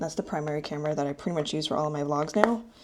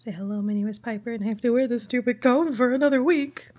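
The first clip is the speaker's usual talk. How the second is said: quicker silly high voice for the dog; weird pet voice; 5 semitones higher, 4 dB louder than usual. Pretend. Owner voicing dog complaining about cone, silly voice. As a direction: keep the goofy dog voice going. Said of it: "I'm doing that weird accent".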